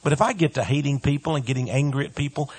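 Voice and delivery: ordinary delivery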